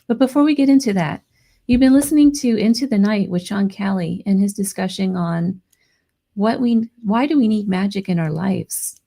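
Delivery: normal talking voice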